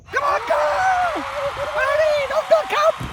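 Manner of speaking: high urgent yells at the ball; will the ball in, shouting; excited shouting. Real, not acted. Urging ball onto green.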